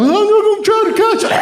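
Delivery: high, strained mock panic; hammed-up wheezy chase voice; asthmatic wife. Imitating asthmatic wife chasing the runaway dog, frantic and high. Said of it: "there goes my asthmatic wife".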